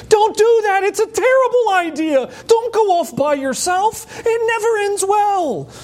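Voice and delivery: high, loud warning cry at a horror movie; yelling at the screen. Pretend. Viewer yelling at a scary movie screen in panic. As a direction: shout a warning at the dumb character. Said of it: "you feel like yelling at the screen".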